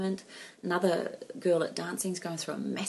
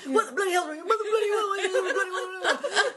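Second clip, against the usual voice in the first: high, loud mock panic with laughter; panicking teenage boy; 12 semitones higher, 3 dB louder than usual. Imitating her son panicking at his sister's state, high and laughing. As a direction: ham up a frantic boy, laughing through it. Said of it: "You know what boys are like, like".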